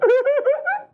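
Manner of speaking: a sudden high squeal of a laugh; high mocking laugh. Preacher mocks a crying general with a high laugh.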